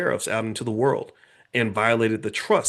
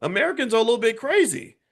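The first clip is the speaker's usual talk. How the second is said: doubting countries; 11 semitones higher than usual. Retold or imitated. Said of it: "Countries are like".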